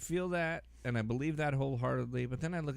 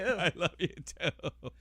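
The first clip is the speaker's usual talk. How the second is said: mock tender; 7 semitones higher, slower than usual. Therapist hugging back.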